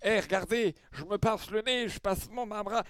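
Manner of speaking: put-on high, showy drunk insisting; mock drunk proving himself; trying to sound sober. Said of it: "quand t'es là en mode".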